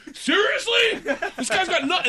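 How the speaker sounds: high, loud laughing mock-irate; laughing mock outrage. Complaining to the therapist about the gardener, in laughing mock outrage. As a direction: play fake fury through laughter.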